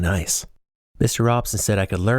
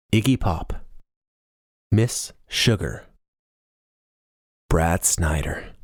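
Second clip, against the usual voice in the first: slow, awed reading; slower than usual. A narrator's reading. Reading the marquee in awe.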